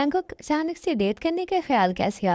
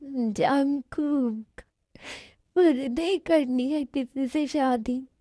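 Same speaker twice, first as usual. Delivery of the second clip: slow, tearful whine; acted weepy protest; crying; 3 semitones higher, slower than usual. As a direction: wail the refusal through sobs. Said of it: "पहले रोना बंद करो".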